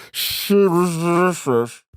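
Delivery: "Shame is delicious" drawn out slow, sh sounds stretched; slow lisped sh. Shown as a demonstration. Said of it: "so many certain sh sounds".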